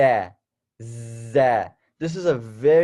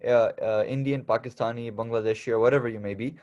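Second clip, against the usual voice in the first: ordinary talk, nothing marked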